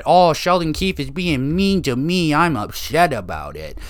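high, whiny crybaby voice, then dropping back; hammed-up whining to daddy; mock whiny child. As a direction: whine it like a spoiled kid tattling. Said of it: "go whine to your, to daddy".

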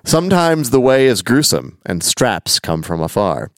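slow, measured recital of song lyrics; deliberate, rhythmic read-aloud; verse recitation. Acted. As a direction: recite the verse without singing it.

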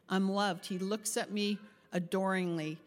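ordinary delivery

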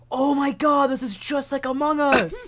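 high-pitched imitation of a friend exclaiming; Will. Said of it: "Will just goes".

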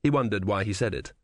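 ordinary delivery, nothing marked

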